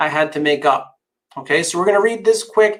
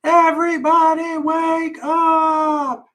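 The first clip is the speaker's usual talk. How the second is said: long, high wake-up call held on every syllable; high drawn-out shout; 10 semitones higher, slower than usual. Acted. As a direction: shout it slowly to wake the whole house. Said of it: "and screeched".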